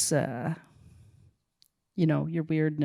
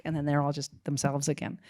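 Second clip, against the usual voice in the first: ordinary talk, nothing marked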